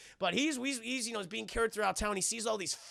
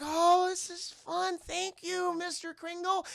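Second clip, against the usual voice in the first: put-on high, slow little-kid voice; drawn-out, high-pitched mock-child gratitude; delighted kids; 11 semitones higher, slower than usual. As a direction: imitate children sweetly saying thanks. Grateful kids voiced in a story.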